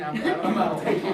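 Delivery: loud, laughing through the words. Real, not acted. Laughing at his own joke.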